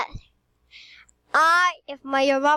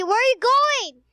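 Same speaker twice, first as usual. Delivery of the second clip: calling out; 11 semitones higher than usual. Real, not acted. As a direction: call after her across the room. Calling after sister leaving.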